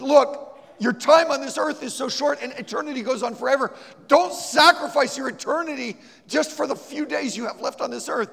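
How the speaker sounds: high, loud urgent plea; pleading. Retold or imitated. The preacher begs her to believe, high and loud. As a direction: beg her with everything. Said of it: "I began to beg her".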